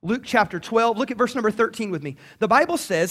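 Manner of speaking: usual speaking voice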